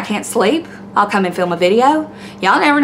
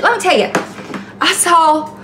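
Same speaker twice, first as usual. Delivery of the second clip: ordinary talk, nothing marked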